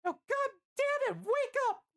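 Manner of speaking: very high falsetto shout; shouting Licia. A narrator's reading. Licia orders fading Bill to wake, shouting. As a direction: snap him awake in a forceful falsetto. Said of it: "Licia shouts".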